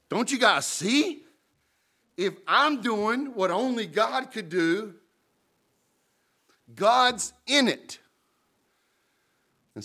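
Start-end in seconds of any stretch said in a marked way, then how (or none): 0.1-8.0 much higher and slow, exasperated; pleading Jesus. Retold or imitated. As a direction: plead with them, slowly and high. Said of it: "And he goes".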